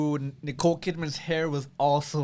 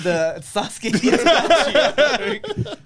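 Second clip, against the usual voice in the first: laughing excitedly; 11 semitones higher, 5 dB louder, much slower than usual. Retold or imitated. Excited anime comparison, laughing.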